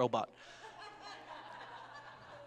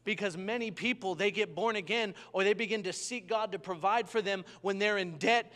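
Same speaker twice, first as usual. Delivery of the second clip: rising, louder preacher's build; building preaching; 4 semitones higher, 5 dB louder than usual. Acted. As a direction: drive the sermon up toward a climax. Preaching builds to full force.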